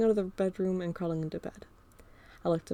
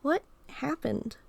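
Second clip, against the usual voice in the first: gentle slow question; 7 semitones higher, much slower than usual. Acted. Carl asking gently.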